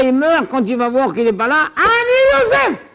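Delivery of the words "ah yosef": dramatic revelation. Acted. Yosef reveals himself.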